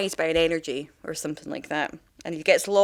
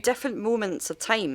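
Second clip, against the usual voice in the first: ordinary delivery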